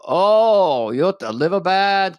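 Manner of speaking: hammed-up, half-hearted Norwegian acupuncturist accent; mock Norwegian accent taking your pulse; bad Norwegian accent. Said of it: "I'm doing a Norwegian accent, just a bad one".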